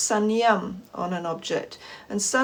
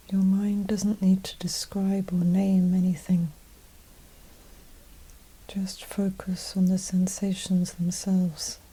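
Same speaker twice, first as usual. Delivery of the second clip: guided meditation; 2 semitones lower, 4 dB quieter than usual. Acted. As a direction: gently guide them inward, unhurried and even, leaving space after each phrase.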